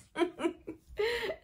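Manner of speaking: high, short laugh; embarrassed laugh about her voice. Real, not acted.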